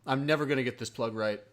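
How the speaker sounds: ordinary talk, nothing marked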